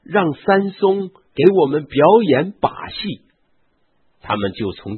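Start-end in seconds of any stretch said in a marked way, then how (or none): 0.0-3.2 high, loud crowd-like call. A narrator's reading. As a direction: shout out a rowdy demand. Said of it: "就喊说". (高声叫喊)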